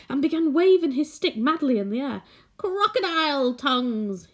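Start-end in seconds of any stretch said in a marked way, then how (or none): 2.6-4.3 cried out. A narrator's reading. Old man waving his stick announces the secret with a mild cry. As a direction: burst out with wild glee, lightly. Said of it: "he cried".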